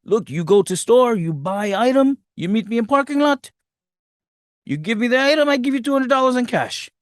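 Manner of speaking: put-on lightly accented broken English; a hint of a foreign boss giving orders; mock accent. Theo Rossi's character instructing her, lightly accented. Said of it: "He's like".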